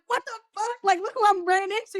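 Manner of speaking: high, excited reenacted outburst; thrilled, high-pitched retelling; starstruck amazement. Retells meeting the celebrity.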